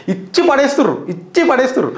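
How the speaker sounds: excited cheer. Real, not acted. Gleeful cheering of minister's retort.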